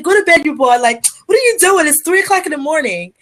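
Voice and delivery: put-on Liberian-accented telling-off at 3 a.m; wife scolding husband in her Liberian accent. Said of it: "in my Liberian accent".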